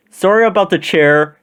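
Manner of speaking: high-pitched parting call. A narrator's reading. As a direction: call it back from the departing sleigh. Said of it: "Santa called".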